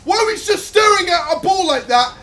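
loud fast angry shouting; fuming at the defenders letting it in; frustrated rant. Real, not acted. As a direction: rant at your useless defenders. Furious at his defenders.

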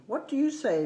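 high sing-song rise on you; playful question to the animal. Acted.

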